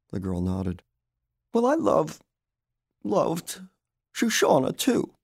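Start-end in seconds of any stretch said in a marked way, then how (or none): 1.5-5.1 high, halting, grieving voice; choked, pitched-up protest of love. A narrator's reading. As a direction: break the sentence up with emotion. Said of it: "She choked up".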